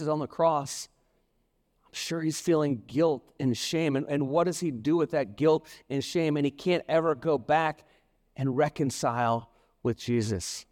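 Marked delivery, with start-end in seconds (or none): none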